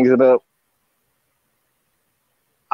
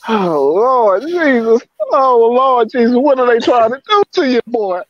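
high, pleading cry to the Lord; upset, near tears; despairing lament; 10 semitones higher than usual. Real, not acted. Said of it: "I'm just so emotional right now".

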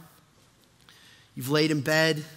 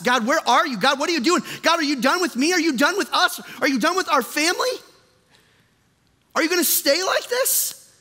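high-pitched, urgent plea to God; pleading preaching; 10 semitones higher, 4 dB louder than usual. Acted. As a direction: fire off questions to God, voice raised high. Voices the anguished questions.

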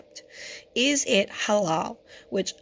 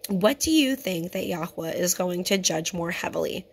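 normal talking voice

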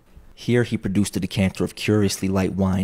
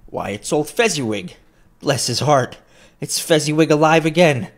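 building to a loud, higher joyful cry; great excitement; 8 semitones higher, 5 dB louder than usual. A narrator's reading. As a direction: burst out in delight at the old master. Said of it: "Scrooge cried in great excitement".

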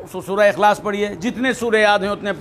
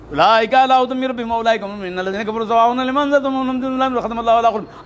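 Quran verses rattled off fast in chanting style; rapid Arabic recitation; faster than usual. Retold or imitated. Preacher showing the congregation how people rush the Arabic, in fast recitation. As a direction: race through the Arabic like a practised reciter. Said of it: "अरबी पढ़ रहा है".

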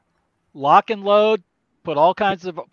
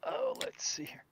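whispered aside; 17 dB quieter than usual. Real, not acted. Muttered aside while checking.

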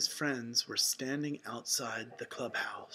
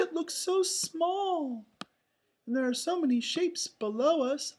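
excited cartoon voice; 11 semitones higher, 4 dB louder than usual. A narrator's reading. Friends looking down from the balloon, excited high voice. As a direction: exclaim with squeaky wonder. Characters delighted in floating balloon.

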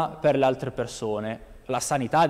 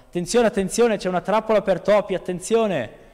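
higher, urgent alarm call to everyone; frightened shouting; 6 semitones higher than usual. A narrator's reading. Scared mouse warning the farm animals about the trap, alarmed shout. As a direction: cry out the warning in a panic. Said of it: "ha iniziato a urlare a tutti gli animali".

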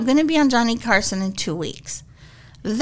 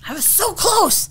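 retold anguish; 8 semitones higher than usual. Retold lament after failed audition.

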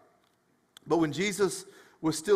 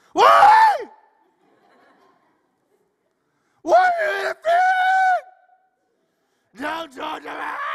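shrieked scream; 12 dB louder than usual. Acted. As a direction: scream it like a man possessed, stretching the words. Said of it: "he shrieks the scream".

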